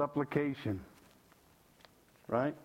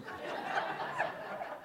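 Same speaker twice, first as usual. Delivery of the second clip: high airy laugh; 5 dB quieter than usual. Real, not acted. Laugh about wife's arguing.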